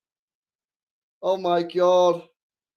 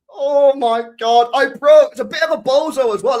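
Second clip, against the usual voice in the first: loud, fast excited yelp; a loud gleeful shout at the screen; excited outburst; 5 semitones higher, 7 dB louder than usual. Real, not acted. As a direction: burst out in thrilled disbelief. Excited reaction to goal.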